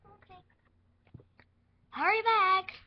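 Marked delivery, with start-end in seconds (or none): 1.9-2.7 calling out. Pretend. Doctor calling after nurse.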